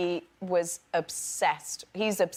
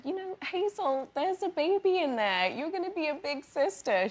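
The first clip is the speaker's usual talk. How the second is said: gentle mom voice; 7 semitones higher than usual. Retold or imitated. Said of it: "when I said".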